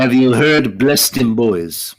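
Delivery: slow, mock Russian accent; podcast host joking about Russian listeners in a hammed-up Russian accent. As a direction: ham up a Russian accent, drawing it out. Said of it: "that's my best Russian accent".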